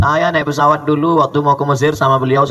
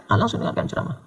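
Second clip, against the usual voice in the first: whispered aside, no voice; 10 dB quieter, faster than usual. Real, not acted. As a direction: hush it like a secret. Berbisik ke jamaah. (berbisik pelan)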